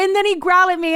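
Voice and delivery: high, loud mock-distraught whine; hammed-up wounded complaint; mess on the phone. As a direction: plead it as if near tears. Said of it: "I was a mess on the phone".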